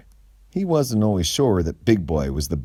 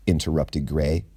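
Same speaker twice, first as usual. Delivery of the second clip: usual speaking voice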